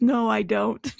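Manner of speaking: normal talking voice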